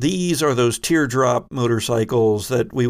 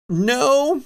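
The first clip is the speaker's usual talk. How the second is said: high joke voice; 14 semitones higher than usual. Pretend. Joke answer about moving in sync.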